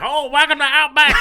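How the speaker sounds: loud, high Southern-sounding waitress; big hammed-up Southern greeting; botched Australian accent turned Southern. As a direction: attempt Aussie, land in a Southern accent. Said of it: "That was Southern".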